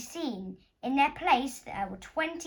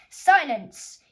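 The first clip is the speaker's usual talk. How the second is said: loud sharp shout; 3 semitones higher, 13 dB louder than usual. Acted. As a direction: bark it out loud. Teacher cuts her off.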